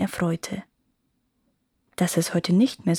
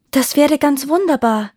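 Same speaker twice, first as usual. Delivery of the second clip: overjoyed, higher and louder; delighted; 6 semitones higher, 6 dB louder than usual. A narrator's reading. Seamstress thanking the fairy, delighted. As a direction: burst out with happy relief. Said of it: "freute sich Benedetta".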